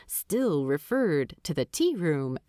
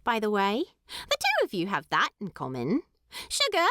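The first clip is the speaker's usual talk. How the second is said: high, fast, prim hobbit lass teasing; higher fast voice of a crisp hobbit lady; Primula; 4 semitones higher, faster than usual. Acted. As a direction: rattle off the rules like a proper young hobbit. Primula chatters at Thorin.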